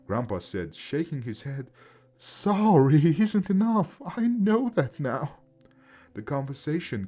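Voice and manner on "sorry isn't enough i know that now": high, slow and loud; loud and firm. Acted. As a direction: insist through anguish. Grandpa answers the ghost.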